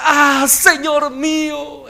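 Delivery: slow, loud, high anguished cry. Acted. Young prophet cries to the man of God, slow, loud and high. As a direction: drag the cry out in despair. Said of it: "De una vez le gritó".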